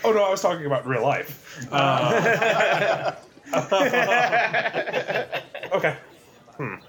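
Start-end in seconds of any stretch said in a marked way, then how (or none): 3.5-5.7 high laugh. Real, not acted. Laughing after misunderstood joke.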